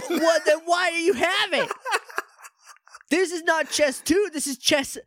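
high-pitched comic indignation; worked-up, incredulous rant for laughs; mock outrage. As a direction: protest in a high voice, playing up the exasperation. Riffing indignantly about a misnamed game.

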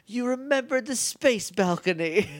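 lightly voiced haughty diva, high. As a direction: toss it off like a grand diva. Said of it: "literally says".

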